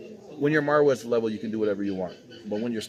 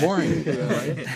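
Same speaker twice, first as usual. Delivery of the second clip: laughing; 3 semitones higher, 4 dB louder, much slower than usual. Real, not acted. Laughing at the dull imitation.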